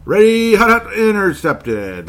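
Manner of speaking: loud, high snap count sinking into slow dismay; mock quarterback shout. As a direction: yell the cadence, then stretch out the letdown. Re-enacts the intercepted play, mocking.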